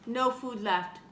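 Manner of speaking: usual speaking voice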